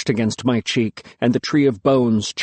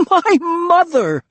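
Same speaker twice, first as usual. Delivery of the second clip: wild laughing; 4 dB louder, slower than usual. A narrator's reading. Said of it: "I laughed wildly".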